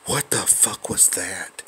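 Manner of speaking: ordinary delivery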